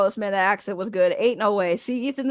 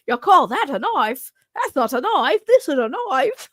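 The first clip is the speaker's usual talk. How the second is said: Crocodile Dundee; 3 semitones higher than usual. Retold or imitated. Streamer quoting the funniest film scene, mock Australian accent. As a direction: quote the film in a broad mock Aussie accent. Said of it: "effing scene in Crocodile Dundee".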